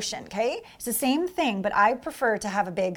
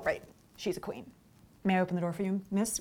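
much softer, courteous admiring man; polite gentleman; 3 semitones lower, 8 dB quieter than usual. Retold or imitated. Flustered man politely offering to open the door. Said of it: "will go".

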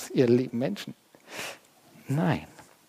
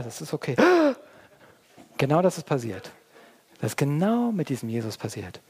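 normal talking voice

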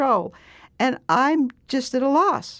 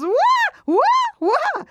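high, loud ape "wah wah" squeaks; bonobo squeak imitation; 5 dB louder, much slower than usual. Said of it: "And he goes".

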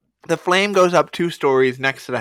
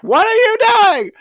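high, loud imitated scream; hammed-up screaming imitation; screaming wife; 5 dB louder than usual. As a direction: shriek at your husband in panic. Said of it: "She starts screaming at the husband".

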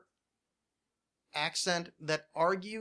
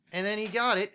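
normal talking voice

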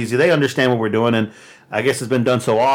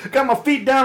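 normal talking voice

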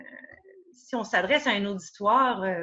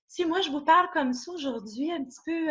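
small vulnerable voice; 6 semitones higher than usual. Shown as a demonstration. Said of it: "avec une petite voix comme ça".

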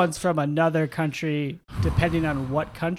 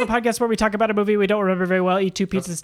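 rushed intro without breathing; all in one breath; 2 semitones higher, faster than usual. Real, not acted. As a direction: race through it on a single breath. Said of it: "Did you do that in one breath?".

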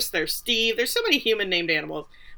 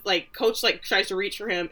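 normal talking voice

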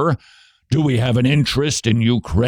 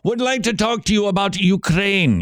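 higher, playful Scottish brogue; Scottish accent; 8 semitones higher than usual. Acted. Radio host jokingly talking to listeners in a Scottish accent. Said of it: "using your Scottish accent".

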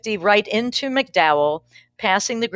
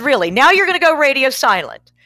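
higher and louder, bitter and fed up; exasperated yell; 7 semitones higher, 8 dB louder than usual. Retold or imitated. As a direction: snap it at a silent passenger. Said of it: "I was mad at God".

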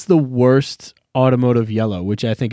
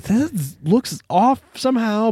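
puzzled; 10 semitones higher, slower than usual. Retold or imitated. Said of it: "I was like".

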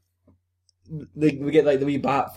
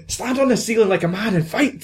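high put-on combative cry; high-voiced dare; acted fight challenge; 8 semitones higher than usual. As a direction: throw down a challenge, pitched up.

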